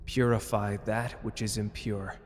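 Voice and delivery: spell incantation. Acted.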